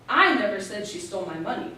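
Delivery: "I" stressed, the rest falling away. Shown as a demonstration. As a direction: lean hard on the first word "I". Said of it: "You can stress each word in that sentence".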